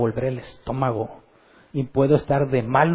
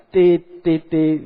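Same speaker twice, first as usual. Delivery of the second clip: short honks mimicked with the voice, loud at first; imitated car horn beeps; 3 semitones higher, 5 dB louder, much slower than usual. Preacher mimics a coworker honking at a driver, playful horn beeps. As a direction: beep the horn with your voice. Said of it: "Con el claxon".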